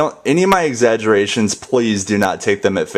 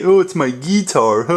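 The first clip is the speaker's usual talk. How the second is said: a higher, lightly mocking "Oh, it's my guitar"; mock other people; 7 semitones higher than usual. Mimicking how some people say "guitar", lightly mocking. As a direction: put on a prim voice for "guitar". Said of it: "Some people say".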